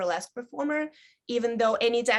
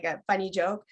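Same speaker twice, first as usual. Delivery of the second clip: normal talking voice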